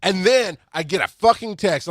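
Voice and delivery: usual speaking voice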